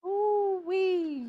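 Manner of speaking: usual speaking voice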